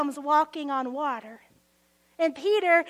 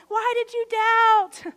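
slow, louder, higher "Why did you doubt?"; emphatic repeat; 4 semitones higher, 6 dB louder than usual. Real, not acted. Preacher repeats Jesus' question to the crowd, slow and pressing. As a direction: press the question home to the congregation. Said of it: "Peter doubted himself in that moment".

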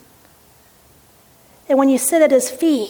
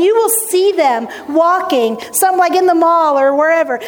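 ordinary delivery

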